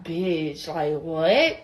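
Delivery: low, soft, offhand "bitch"; casual disbelief. Retold or imitated. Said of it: "So I was like".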